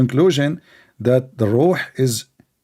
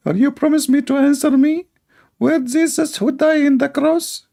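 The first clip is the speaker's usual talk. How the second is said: very high, squeaky mimicry; mock challenger; 14 semitones higher than usual. Host imitating someone demanding an answer about Jesus, high mock voice. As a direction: mimic a pleading caller in falsetto.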